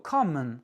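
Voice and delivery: common said as COM-un, stress first syllable; English word common. Shown as a demonstration. Teacher models common to French learners. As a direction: reduce the O N to a schwa. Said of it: "Com est accentué".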